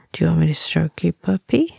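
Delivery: quick, understated question; quick casual question. Acted. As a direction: ask it quickly and lightly, rising at the end. First asking, before the retry.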